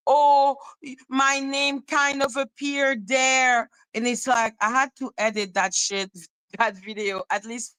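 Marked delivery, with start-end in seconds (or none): 0.1-3.7 high, slow, drawn-out mimicked whine; mock complaint. Mimicking objections that forced each video edit, as a mock complaint. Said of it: "each time there was something like".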